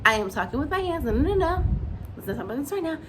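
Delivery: lightly voiced indignant dad; a hint of a man playing the victim; mocking Martell. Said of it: "I just says".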